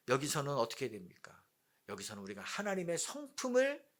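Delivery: ordinary delivery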